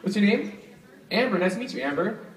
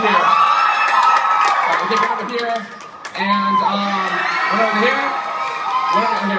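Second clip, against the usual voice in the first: calling out; 2 semitones higher, 8 dB louder than usual. Real, not acted. Tossing t-shirts, calling out.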